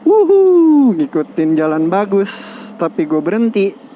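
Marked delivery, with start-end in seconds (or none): 0.1-0.9 loud high whoop of joy; excited whoop. Real, not acted. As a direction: let out a thrilled whoop. Whoop while riding.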